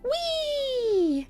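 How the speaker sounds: long high gleeful squeal; high whee. Acted. Aliens zooming away from Mum, high gleeful squeal.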